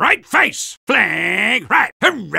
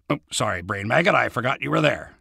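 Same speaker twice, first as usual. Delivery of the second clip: softer, lower sheepish aside; quiet apology; 9 semitones lower, 7 dB quieter, faster than usual. Acted. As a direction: mumble a quick sorry to the bug. Apologizing to the brain maggot.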